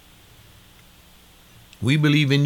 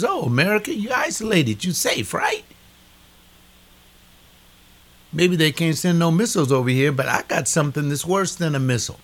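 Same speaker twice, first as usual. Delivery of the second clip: high, taunting voice of God warning America; mock-sweet God taunting a proud nation; 4 semitones higher than usual. Preacher voicing God taunting America, high and mocking. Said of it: "God says".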